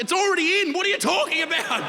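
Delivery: put-on incredulous squeal at the girlfriend's request; shocked disbelief. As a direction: blurt it out in stunned confusion. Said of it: "I'm like".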